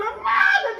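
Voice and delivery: loud, high scream of release; screaming out the car window to let go; long high scream. Retold or imitated. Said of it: "I'm screaming".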